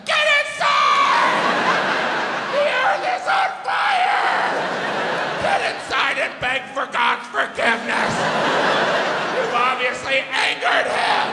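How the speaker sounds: high, shouting hellfire warning; doomsday preacher. Retold or imitated. Comedian yelling at Vegas locals like a hellfire preacher. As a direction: scream it like the apocalypse is here. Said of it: "I was like".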